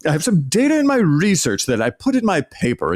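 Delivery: hammed-up pretend-professor voice; mock pseudo-academic. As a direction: send up a cosplaying professor one-upping colleagues. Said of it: "what all of us would think British archaeologists talked about".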